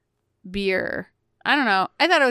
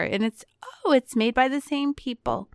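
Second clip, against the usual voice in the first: usual speaking voice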